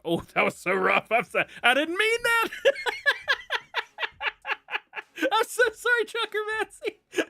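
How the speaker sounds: laughing hard through an embarrassed apology; can barely get the sorry out for giggling. Real, not acted. Streamer laughing at own slip to viewer Trucker Matsy. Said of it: "It was a good giggle".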